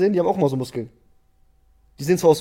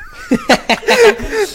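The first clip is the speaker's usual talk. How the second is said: high, loud burst of laughter; a squealing real laugh; 6 dB louder than usual. Real, not acted. Cracking up at his friend's sport pick, high laughing.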